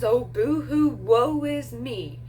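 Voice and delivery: drawn-out sarcastic moping; mock self-pity. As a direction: mimic a pathetic sulk, stretching the words. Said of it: "him getting mopey and all sad and pathetic".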